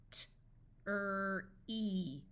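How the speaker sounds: T, then "er", then "ee", each separate; sounded out. Shown as a demonstration. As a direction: break "T-er-e" into three slow sounds. Phonics teacher sounds out, then blends.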